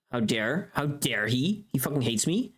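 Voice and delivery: ordinary delivery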